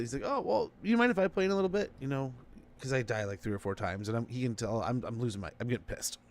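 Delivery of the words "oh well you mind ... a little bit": lightly voiced friend, higher and sweetly innocent. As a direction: play the friend faking innocence. Friend asks to take controller.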